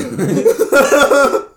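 loud high laugh; real laughing fit; burst of laughter. Real, not acted. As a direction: crack up helplessly. Breaks up laughing.

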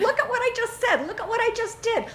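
high, laughing disbelief at yourself; amused self-discovery. Retold or imitated. Parent seeing own attitude mirrored, laughing high. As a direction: marvel at your own behavior. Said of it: "you're going".

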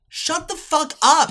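loud, high, slow mock yell; mock yelled retort. Friend mocking her dentist dad, loud and high. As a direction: snap it out at the chatty dentist.

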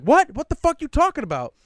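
high, loud, incredulous outburst; retold shocked disbelief. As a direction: react aghast to a wild accusation. Retold shock at friend's false accusation.

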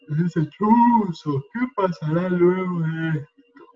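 slow, sleepy inner voice fretting; yawning, heavy-lidded worrying; drowsy worried voice. Shown as a demonstration. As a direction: let each worry drift out half asleep. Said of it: "noten cómo esa voz es así, relajada, dormida, somnolienta".

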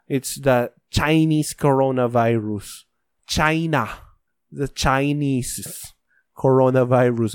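ordinary talk

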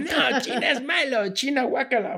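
mocking childish disgust. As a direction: play a kid saying yuck to China. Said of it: "Entonces dicen". (imitación burlona de asco infantil)

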